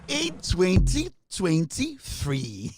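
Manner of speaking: slow, drawn-out "free" for three, mocking; mocking Atobra's English. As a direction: drag out "twenty twenty free" like a clumsy reader.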